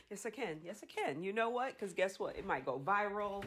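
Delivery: very soft mumble to yourself; hushed private reasoning; muttered self-talk. Real, not acted.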